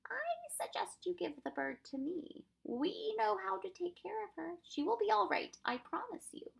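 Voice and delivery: gently persuade, easing down from the scolding. A narrator's reading. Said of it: "in a quieter voice".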